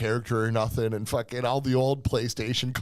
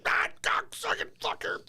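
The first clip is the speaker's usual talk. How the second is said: imitated yelling wife; 5 dB quieter than usual. Husband imitating his wife yelling from the basement, strained mock scream. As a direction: mimic a distant rage scream, held back. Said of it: "I'd hear her fucking yelling on that shit".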